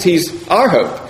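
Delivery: high emphatic "our", dropping onto "hope"; stress on OUR. Shown as a demonstration. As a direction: punch "our" in "He's our hope". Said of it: "we can put the emphasis on".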